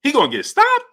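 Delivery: high, disbelieving rise on stopped; incredulous. Retold or imitated. Streamer reacting to Andre Ward's prediction in disbelief. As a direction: question it in disbelief. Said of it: "I'm like".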